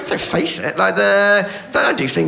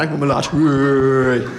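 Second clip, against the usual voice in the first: laddish whoa, louder at the end; imitating a lad; 2 semitones lower, 3 dB louder, much slower than usual. Mate [name] showing off as a lad, mock whoop. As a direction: ham up a proud lad. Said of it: "Makes the lad noise all the time".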